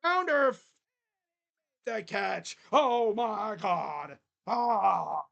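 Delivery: excited call. Real, not acted. Calling a big play.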